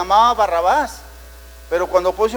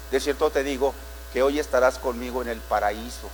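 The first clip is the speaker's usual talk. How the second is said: Jesus speaking gently; 8 semitones lower, 6 dB quieter than usual. Acted.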